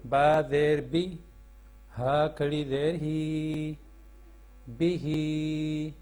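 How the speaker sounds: slow sing-song spelling chant, drawing out each joined word; measured classroom recitation of the Noorani Qaida. Shown as a demonstration. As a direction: chant each letter and its vowel for pupils to repeat. Teacher chants spelling drill.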